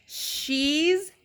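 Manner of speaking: put-on very slow, drawn-out drunken verdict; slow mock drunk put-down; real slow. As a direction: drag every word out like a drunk friend. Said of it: "Real fucking slow".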